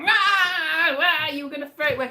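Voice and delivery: loud, high mock wail, then rushed words; imitated upset wail. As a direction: wail it in exaggerated protest. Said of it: "got really upset and started going".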